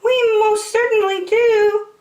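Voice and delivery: very high, loud piping squeak; tiny high mayor piping. Acted. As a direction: pipe it up from far below. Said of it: "piped the voice".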